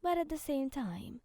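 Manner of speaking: usual speaking voice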